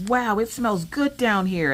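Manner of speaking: put-on high, slow wonder of a kid; kids amazed. Kids coming downstairs, high and slow in wonder. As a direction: sniff the air in wonder like a child. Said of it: "the kids were like".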